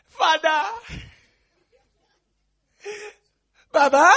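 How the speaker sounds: loud, crying mock prayer; hammed-up sobbing cry to Father; desperate wailing plea. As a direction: wail to God in a panic. Voicing desperate believer in crisis.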